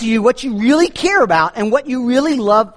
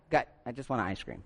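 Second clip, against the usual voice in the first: low, soft and fast exasperated mutter; exasperated aside; 9 semitones lower, 17 dB quieter, faster than usual. Retold or imitated. Customer stuck behind lotto buyer, fast soft exasperated mutter. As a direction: sigh it out fast, fed up. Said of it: "you're like".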